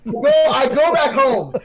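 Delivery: high-pitched, worked-up storytelling; excited exclamation. Real, not acted. Storyteller repeating the reveal to an eager listener, excited. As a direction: push the climax out over the listener, pitched high. Repeats climax over co-host's laughing interjection.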